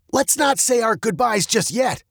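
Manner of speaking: high, animated dad voice; confident dad. A narrator's reading. Dad interrupts the goodbyes, high and assured.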